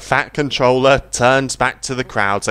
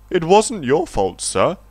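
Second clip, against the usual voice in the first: higher, kindly comfort; reassuring; 6 semitones higher than usual. A narrator's reading. Annie reassures the Fat Controller, kind. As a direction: soothe the boss. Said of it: "Annie reassured him".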